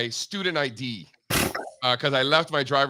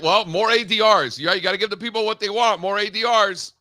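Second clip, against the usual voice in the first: hyped up; 7 semitones higher, 5 dB louder than usual. Acted. Hyping the request up.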